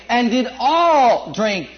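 "all" drawn out and slow; stress on all. Shown as a demonstration. Preacher reading scripture, stressing all. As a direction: hold "all" before drink. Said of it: "Notice the all".

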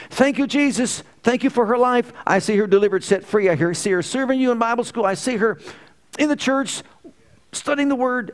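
high, loud joyful thanksgiving; fervent prayer. Retold or imitated. Father praising God on his knees for his daughter. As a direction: pour out thanks to the Lord with fervor.

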